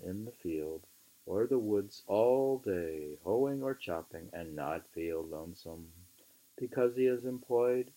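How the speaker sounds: measured, emphatic book reading; reading aloud. Acted. Reading Thoreau aloud to a friend, measured and emphatic. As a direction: read the old essay aloud with weight. Reads on at length.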